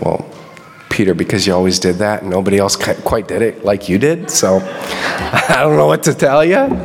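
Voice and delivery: low, easy, casual reply with a chuckle; shrugging John. Retold or imitated. John answering Peter's gripe with a shrug. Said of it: "John's probably like".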